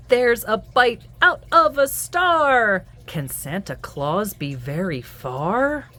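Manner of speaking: lively sing-song picture-book read-aloud; reading to children. A narrator's reading. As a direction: read it to little ones at bedtime.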